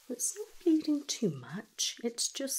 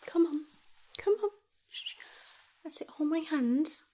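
encouraging urging; 7 semitones higher, 5 dB louder than usual. Acted. Urging patient through pain.

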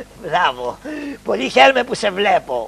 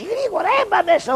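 urgent; 14 semitones higher than usual. Acted. Urgent hiding order.

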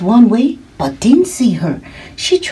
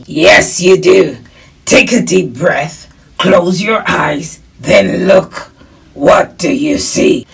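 wolf mentor; 5 dB louder than usual. Acted.

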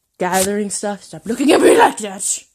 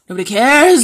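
louder, dismissive callout; loud dismissal; 5 dB louder than usual. Real, not acted. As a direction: call it out dismissively, leaning on the last word. Loud take before whispered repeat.

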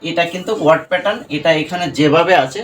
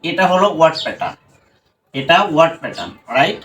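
ordinary talk

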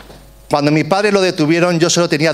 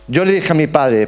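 ordinary talk, nothing marked

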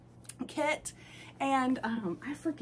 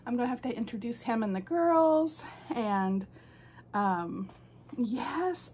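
ordinary delivery

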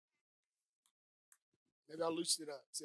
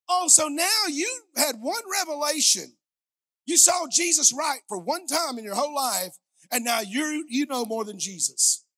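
louder, higher fervent sermon delivery; full-force preaching; 8 semitones higher, 10 dB louder than usual. Acted. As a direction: preach it out to the congregation. Preacher chiding Peter.

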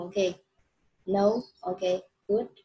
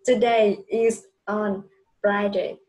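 put-on slow, clear model read for a child; slow model sentence; 6 dB louder, slower than usual. Shown as a demonstration. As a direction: lay out each word slowly for the pupil to copy. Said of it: "Nào con sẽ đọc cho cô cả câu nhé". (đọc mẫu chậm và rõ)

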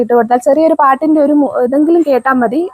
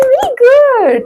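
delighted praise; 10 semitones higher, much slower than usual. Real, not acted. Mother praising her toddler for fetching, delighted. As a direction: stretch the praise out high and slow.

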